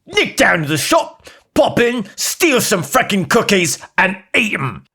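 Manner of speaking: put-on Cockney accent. Pretend Siri answers a cookie recipe in hammed-up Cockney. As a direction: play a cheeky Cockney geezer, up high. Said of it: "a Cockney voice".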